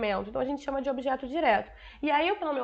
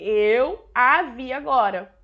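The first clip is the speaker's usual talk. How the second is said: "Eu a vi agora" slow and louder, leaning on the "a"; careful standard form; 2 semitones lower, 6 dB louder, much slower than usual. Shown as a demonstration. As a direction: spell out "Eu a vi agora" slowly, stressing the pronoun "a". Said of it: "por isso que a gramática pede isso aí". (forma padrão, lenta e enfática, destacando o "a")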